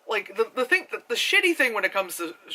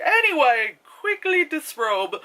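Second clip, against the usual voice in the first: higher, slow and breezy; mock heroine; 8 semitones higher, 3 dB louder, slower than usual. Reviewer mocking the heroine's sudden undressing, higher and breezy. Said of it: "all of a sudden she's just like".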